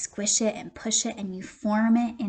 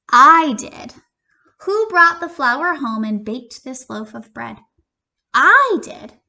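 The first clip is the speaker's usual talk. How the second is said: triumphant hen; 3 semitones higher, 10 dB louder than usual. Acted. Hen to lazy friends, loud proud gloating. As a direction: gloat over the bread you earned.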